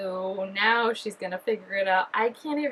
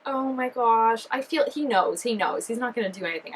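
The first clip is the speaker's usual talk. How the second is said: usual speaking voice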